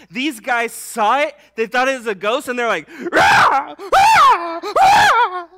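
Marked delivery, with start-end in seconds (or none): 3.1-5.5 loud, high-pitched screams of fright; three involuntary, freaked-out cries at full volume; crow-like terrified scream. Acted. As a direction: shriek like a startled raven. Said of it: "the way that a crow or a raven cried".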